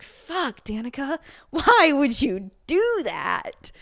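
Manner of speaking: exasperated. Retold or imitated. Exasperated reaction to character.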